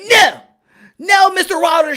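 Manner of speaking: high, loud panicked yelling; mock scream. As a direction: screech it like a scared cornerman. Mocking JD screaming at trainer.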